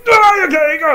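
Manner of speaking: high, loud urgent pleading; strained urging. Acted. Brok urging Sindre to keep pumping, loud and strained. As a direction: push through, then give up with a cry.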